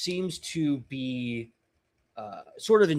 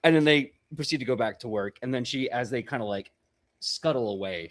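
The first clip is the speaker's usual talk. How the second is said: normal talking voice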